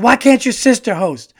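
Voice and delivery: high, loud mock family-dinner gripe; imitating Eddie's dad. Comedian replaying the family sketch, high loud complaining dad. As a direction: squawk it like the annoyed dad at the table. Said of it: "And he's like".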